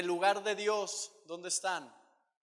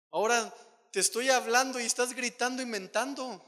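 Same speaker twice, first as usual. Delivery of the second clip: louder, higher scolding; firm rebuke; 5 semitones higher, 7 dB louder than usual. Retold or imitated. God scolds preacher for shouting and cursing, louder. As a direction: scold him firmly.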